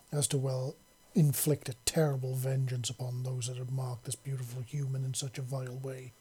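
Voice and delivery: usual speaking voice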